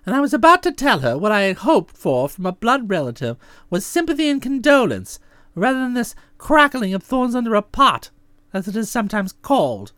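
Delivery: usual speaking voice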